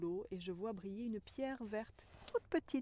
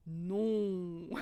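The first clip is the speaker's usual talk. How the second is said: louder, firm booming no; mountain's refusal; 8 dB louder than usual. Pretend. The mountain refusing her, firm and loud. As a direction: answer as the mountain, final. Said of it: "j'entends la montagne qui me dit".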